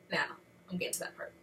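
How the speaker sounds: usual speaking voice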